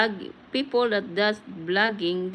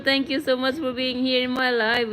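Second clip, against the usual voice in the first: ordinary talk, nothing marked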